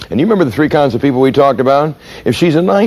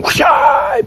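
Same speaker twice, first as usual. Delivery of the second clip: breathy whoosh. Retold or imitated. Cop switching on smile.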